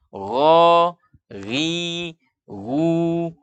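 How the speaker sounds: GHO, RI, GU as heavy throaty Arabic ghain; ghain tebal. Shown as a demonstration. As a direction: raise the tongue root on GHO, RI, GU. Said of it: "terdengar suara itu tebal". (GHO, RI, GU dibaca tebal)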